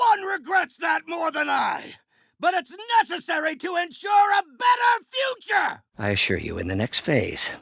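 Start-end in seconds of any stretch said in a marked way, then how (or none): 0.1-5.8 high, forceful declamatory shouting; impassioned shout. Acted. Villain justifies harm for a better future, impassioned high shout. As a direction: shout your justification over a fight.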